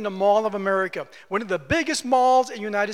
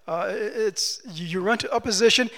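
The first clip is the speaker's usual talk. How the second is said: ordinary talk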